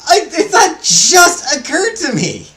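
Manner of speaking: very high, squealing through laughter; laughing disbelief. Real, not acted. Speaker just realizing their computer is non-binary, helpless laughing disbelief. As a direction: crack up loudly at the absurdity.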